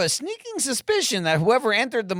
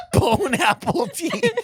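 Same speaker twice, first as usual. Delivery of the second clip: laughing; 9 semitones higher, slower than usual. Real, not acted. Mangled bon appetit joke.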